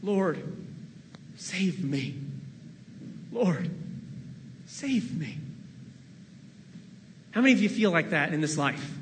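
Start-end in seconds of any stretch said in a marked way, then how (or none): 0.0-5.4 understated plea for help, softer; a quiet, sinking call to the Lord; mild fearful cry. Retold or imitated. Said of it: "and he cried out".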